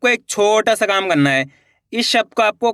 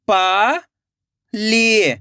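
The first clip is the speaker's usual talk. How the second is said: पाली split into पा, long pause, ली; rising पा, then falling ली; syllable by syllable; much slower than usual. Shown as a demonstration. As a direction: sound out Pali very slowly in two parts. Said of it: "एक एक अक्षर का उच्चारण करना है".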